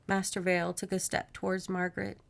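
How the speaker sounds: ordinary delivery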